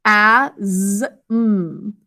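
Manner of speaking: "a, z, m" one sound at a time; short vowel, held buzz, then hum for "a, z, m"; separate sounds. Shown as a demonstration. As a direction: sound out "a, z, m" slowly. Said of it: "last syllable is".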